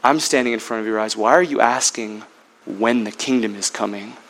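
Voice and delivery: Jesus. Acted. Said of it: "And now he says".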